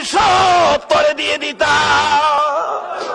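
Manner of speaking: high, fervent cry with long-held endings; full-force preaching. Acted. Usman offering all his wealth for one tree, full-force preaching. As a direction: proclaim it high and hard, stretching the last word.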